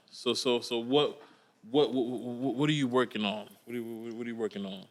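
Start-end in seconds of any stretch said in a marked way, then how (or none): none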